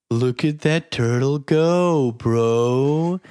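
lazy slow drawl; slow stoner drawl. Retold or imitated. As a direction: drag it out like a stoner. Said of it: "a gentleman going".

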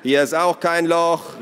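high, loud, drawn-out call. A narrator's reading. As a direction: shout it across the yard, stretched. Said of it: "rief Naru". (laut und gedehnt gerufen)

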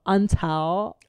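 "until" with an open, drawn-out ending, like "untal"; mock girl-speak "until"; girl-speak vowel. Shown as a demonstration. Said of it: "women did not talk like that until".

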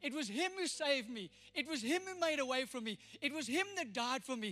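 loud and high, HIM hit in every line; rising preached testimony. Acted. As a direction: build each line like a climax.

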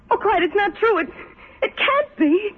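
high, rising desperate pleading, near tears; pleading anguish. Acted. Jilted Anne begging Clyde on the phone, high desperate pleading. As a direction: beg him as your world falls apart.

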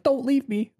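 high mock pleading; hammed-up neediness at normal volume; beaten partner. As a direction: beg like a clingy, abandoned lover. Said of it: "like a beaten partner in a relationship".

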